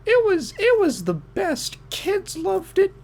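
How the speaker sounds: put-on gushing older lady in high falsetto; older woman. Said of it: "And she goes".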